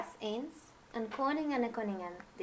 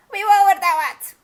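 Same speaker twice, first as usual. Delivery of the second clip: high, much louder croaking witch voice; old witch; 11 semitones higher, 15 dB louder than usual. Acted. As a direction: screech down from the treetop like an old hag. Old witch answers from tree.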